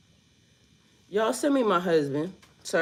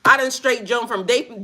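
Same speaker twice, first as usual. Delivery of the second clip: ordinary delivery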